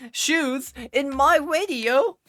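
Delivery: high, loud, drawn-out outcry; over-the-top high-pitched holler; mock exclamation. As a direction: belt it out slowly in mock shock.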